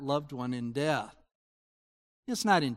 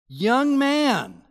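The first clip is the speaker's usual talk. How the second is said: commanding call; 8 semitones higher, 8 dB louder than usual. Acted. Jesus calls to the corpse.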